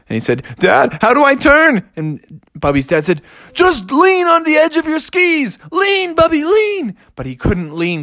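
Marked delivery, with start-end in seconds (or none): 0.6-1.8 high, urgent call for help; alarmed cub. Acted. Cub losing control on skis. 3.5-7.0 high-pitched coaching yell; urgent father. Acted. Father shouts skiing instructions.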